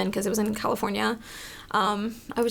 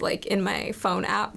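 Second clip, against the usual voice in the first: ordinary talk, nothing marked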